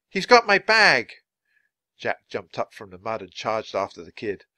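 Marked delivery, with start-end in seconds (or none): 0.1-1.1 high, loud outcry; crying out. Acted. Boy pointing out the thief, crying out high and loud. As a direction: shout it to anyone who can help. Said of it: "cried Jack".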